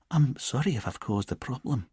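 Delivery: soft lilting Edinburgh Scots; a gentle Edinburgh lilt, softly; light Edinburgh accent. A narrator's reading. Amy apologising to Richard in a soft Edinburgh accent. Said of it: "in her lilting Edinburgh accent".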